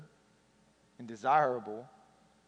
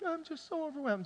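overwhelmed crying; 13 semitones higher than usual. Retold or imitated. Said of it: "as you're sitting there crying".

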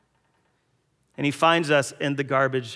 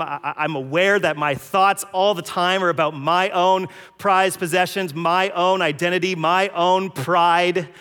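louder, higher, slowing hard on my own pride; impassioned confession; 6 semitones higher, 7 dB louder than usual. Acted. Believer confessing before the Father's house, impassioned. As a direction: pour out the guilt, slowing at the end. Preacher voicing sinner's confession.

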